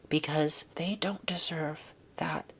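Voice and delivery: slow hushed aside; whispering. Real, not acted.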